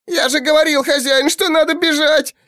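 a very high, thin, squealing creature voice; wailing goblin. A narrator's reading. The frightened goblin servant wails at his master that they should have fled. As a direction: wail it like a panicked little goblin. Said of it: "завопил Кхол".

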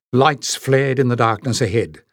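ordinary delivery, nothing marked

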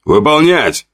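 sharp, high barked command; barked order. A narrator's reading. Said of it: "рявкнул командир аванпоста".

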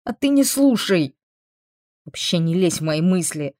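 heated, defiant retort; snappish. A narrator's reading. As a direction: snap back indignantly. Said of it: "огрызнулась рабыня".

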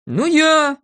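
high-pitched grudging confession; reluctant admission. A narrator's reading. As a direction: own up defensively. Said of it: "сознался Незнайка".

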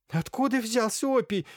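high, loud, flustered cackle of protest; indignant clucking. A narrator's reading. Irina protests there was never opium at home, indignant clucking. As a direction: cluck it out like an upset hen. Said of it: "раскудахталась Ирина Лукинишна".

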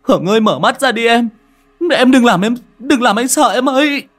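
high, loud panicked cries; frantic despair. Acted. Boy waking to his unconscious girlfriend, frantic crying. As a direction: wail in horror at what you did. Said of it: "như điên như dại".

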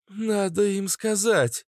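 plain reading voice

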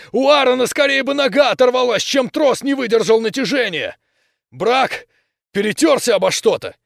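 loud, high, heated rant; outraged yell. A narrator's reading. Jose arguing over the snapped cable, yelling. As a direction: yell in furious disbelief. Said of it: "заорал Хосе".

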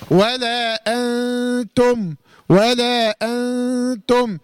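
ولا أنتم very slowly, the ن of أنتم hidden in a long nasal hum; nasal ikhfa. Shown as a demonstration. Teacher shows the hidden nun with ghunna. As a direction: hum the ن in أنتم through the nose, tongue tip off the gum. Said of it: "فبقولها بقى إزاي".

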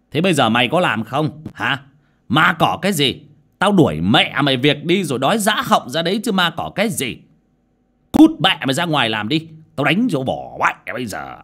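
red-faced boss tearing into the worker; furious boss. A narrator's reading. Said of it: "mặt đỏ tía tai". (chủ thầu quát tháo giận dữ, cao và to)

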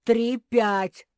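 high, very loud repeat; stubborn insistence. A narrator's reading. Bear cub refusing correction, stubborn shout. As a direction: dig in and shout the count. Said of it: "упёрся медвежонок".

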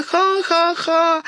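slow, high ha-ha-ha of the wind; imitating a laughing wind. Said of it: "и ветер смеётся".

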